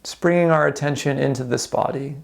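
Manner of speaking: calm, unhurried meditation guide with long pauses; guided meditation. Real, not acted. Instructor guiding listeners through a body scan, calm guided meditation. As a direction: ease them gently through a body scan, leaving space between phrases.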